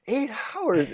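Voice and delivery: high incredulous mock gasp of a question; shocked disbelief. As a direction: recoil at the number. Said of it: "And he was like".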